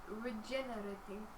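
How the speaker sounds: slow, soft Russian accent; accidental Russian accent. Real, not acted. Player puzzling over the brick, slipping into a Russian accent. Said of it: "Don't know why I went Russian there".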